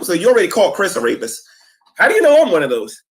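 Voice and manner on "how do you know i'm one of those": loud, high, crying out in protest; indignant shout. Real, not acted. Defending himself against Shanny's accusation, indignant cry. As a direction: yell it, voice cracking high.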